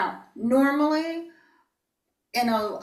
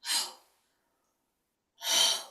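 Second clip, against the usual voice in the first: whispered ho, pushed out on the breath; breathy whispered ho; 4 dB quieter than usual. Shown as a demonstration. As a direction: huff it out softly on the exhale. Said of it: "breathe it out like a whisper".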